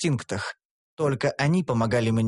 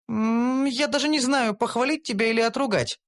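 higher, undecided voice; hesitant elf; 9 semitones higher than usual. A narrator's reading. Elf unsure how to react to hero, hesitant. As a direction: waver between praise and scolding. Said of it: "заметила Карамелька нерешительно".

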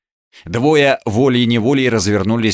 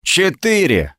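proud, higher-pitched announcement; a young man's boast; 7 semitones higher, slower than usual. A narrator's reading. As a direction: declare it proudly, voice lifted. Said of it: "гордо молвил парень".

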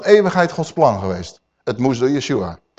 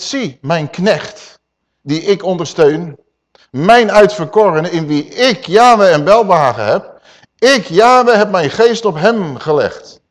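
slow, swelling proclamation, louder on Yahweh; solemn scripture reading; 2 semitones higher, 6 dB louder, slower than usual. Acted. Preacher proclaiming Isaiah to the congregation, slow and solemn. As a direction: declaim the verse slowly like a prophet.

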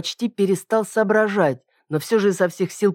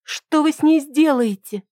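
frightened pleading; 11 semitones higher than usual. A narrator's reading.

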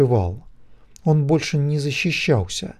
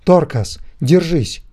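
loud, higher cry urging him to hold on; desperate shout; 5 semitones higher, 5 dB louder, slower than usual. A narrator's reading. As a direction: yell it out, desperate. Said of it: "отчаянно крикнул он". (отчаянный крик)

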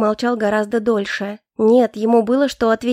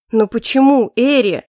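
loud pleading exclamation; exclaiming; 5 dB louder than usual. A narrator's reading. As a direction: cry it out, reaching toward her. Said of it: "воскликнул он". (громко, с отчаянным возгласом)

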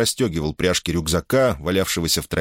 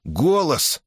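higher-pitched startled exclamation; startled cry; 9 semitones higher than usual. A narrator's reading. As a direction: cry out in alarm, without extra volume. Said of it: "вскрикнул Эрм".

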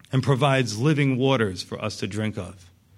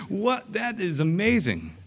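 amazed; 6 semitones higher than usual. Acted. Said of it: "That, that was like".

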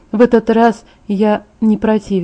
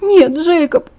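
sobbing; 8 semitones higher, slower than usual. A narrator's reading. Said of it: "всхлипнула я".

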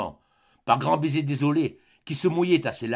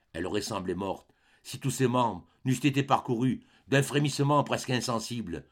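ordinary delivery, nothing marked

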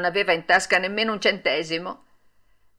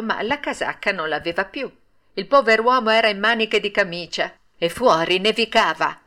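ordinary delivery, nothing marked

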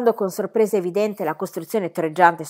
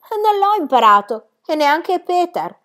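put-on matter-of-fact child; 11 semitones higher, 8 dB louder than usual. Child answers.

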